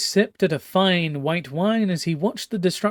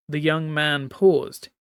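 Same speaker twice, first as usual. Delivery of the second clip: ordinary delivery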